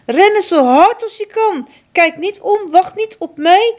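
high, loud frantic urging; urgent desperate order. Acted. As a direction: drive him away from danger. Mother urging escape.

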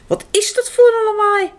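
high, loud startled question; surprised director. Acted. Director hears noise, high surprised shout. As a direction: ask in alarm, pitched high.

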